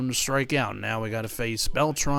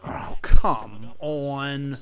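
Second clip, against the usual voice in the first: long, drawn-out exasperated "come on"; exasperated groan; 3 semitones higher, 7 dB louder, much slower than usual. Real, not acted. Gamer groaning at a bad call, drawn-out exasperation. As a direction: stretch it out in disgust. Pitch missed, player reacts.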